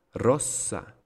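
rossa with short O and long held S; double S. Shown as a demonstration. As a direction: pause on the S inside rossa. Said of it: "¿Se dan cuenta que hay una pausa?".

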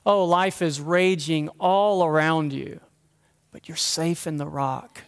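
normal talking voice

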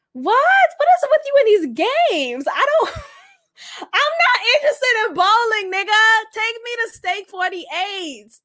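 high-pitched exasperated comeback, laughter breaking in; incredulous mock outrage. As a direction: protest a lousy date idea in disbelief. Mock outraged reply to bowling invite.

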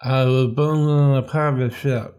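words mangled by a held tongue; holding tongue with fingers. Shown as a demonstration. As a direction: say it while pinching your tongue. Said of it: "Do you ever hold your tongue and say".

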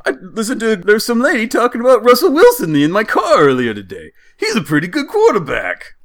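high, loud Jersey cartoon voice; Jersey accent. Driver talks about his earlier passenger, hammed-up Jersey accent. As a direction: gab like an exaggerated Jersey driver. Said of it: "The voice you're doing".

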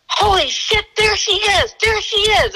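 high, urgent excited call; excited shout. Retold or imitated. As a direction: blurt it out, spotting her. Partner spots the fugitive.

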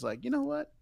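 ordinary talk, nothing marked

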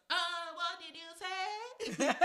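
high, drawn-out imitation of the driver; mock puzzled driver. Imitating the bus driver asking her to repeat. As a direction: stretch the Ah like a baffled driver. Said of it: "you know African Americans".

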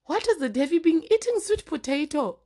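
nosy neighbour. Retold or imitated. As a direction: mimic the neighbour's teasing question. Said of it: "is like".

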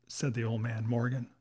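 usual speaking voice

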